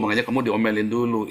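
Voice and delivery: ordinary delivery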